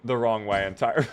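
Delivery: normal talking voice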